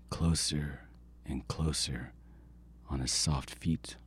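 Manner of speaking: slow hushed whisper; whispered narration. A narrator's reading. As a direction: creep the words out softly. Coyote creeping up.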